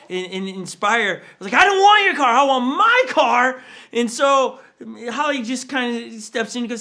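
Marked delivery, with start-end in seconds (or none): 1.6-3.6 put-on high, loud, stubborn child shout; angry kid shout. Boy refusing his brother's car, shouting. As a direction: yell it, refusing the offer.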